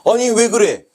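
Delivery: high, loud objection; loud, affronted questioning; indignant protest. Acted. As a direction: burst out in acted outrage. Teacher protests visa rejection.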